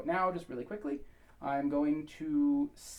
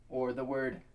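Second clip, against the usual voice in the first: ordinary talk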